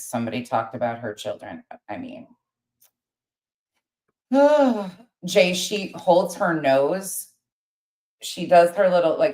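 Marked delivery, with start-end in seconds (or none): 4.3-4.9 high loud exasperated sigh. Real, not acted. Exasperated at rival.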